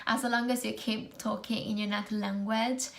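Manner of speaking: usual speaking voice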